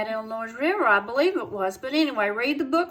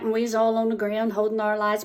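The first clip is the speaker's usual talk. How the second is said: ordinary delivery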